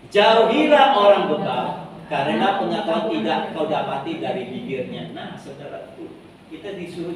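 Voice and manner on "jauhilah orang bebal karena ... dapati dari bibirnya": reading scripture aloud together. Real, not acted. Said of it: "Tiga, dua, satu".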